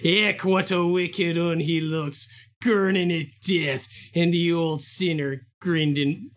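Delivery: hammed-up Yorkshire accent, near a pirate growl; pirate-ish Yorkshire accent. Quiz player reads Joseph's lines for points, bad Yorkshire accent. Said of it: "more of a pirate thing".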